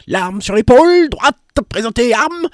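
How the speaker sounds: loud, high drill commands to himself; barked drill commands. Acted. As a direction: bark the orders like a mad sergeant on parade. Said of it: "en se commandant lui-même".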